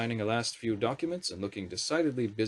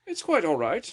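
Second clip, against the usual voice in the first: higher, clipped courtesy meaning the opposite; insincere politeness; 8 semitones higher, 5 dB louder than usual. A narrator's reading. Prime Minister wanting the wizards gone, falsely gracious. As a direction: politely show them the door. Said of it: "her tone indicating the opposite".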